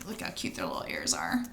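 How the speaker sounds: quick hushed whisper; whispered aside. Real, not acted. Reader whispering to child about the ears in picture. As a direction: whisper it like a secret.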